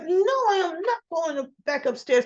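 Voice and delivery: imitating a defiant no; a grieving daughter refuses to be led away, high and firm; indignant refusal. Summer refusing, quarrel.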